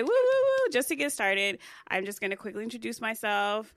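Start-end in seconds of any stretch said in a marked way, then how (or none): 0.0-0.7 high, loud woo-woo cheer. Real, not acted. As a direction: whoop like hyping the crowd. Cheering at show start.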